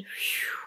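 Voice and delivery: voiceless blown-out calming breath; deep breath out. Acted. Mother and Ava pause to calm down, exhaling deeply. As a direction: blow out a long breath. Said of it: "let out a deep breath".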